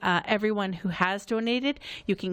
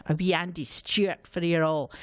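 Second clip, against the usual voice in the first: put-on Scottish accent; 2 semitones lower than usual. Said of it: "which I can somewhat duplicate".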